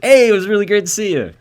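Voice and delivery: high, loud, rushed fake cheer; making a quick exit after losing his friend money, forced cheer; hasty cheerful exit. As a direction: chirp a goodbye and flee. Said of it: "I was like".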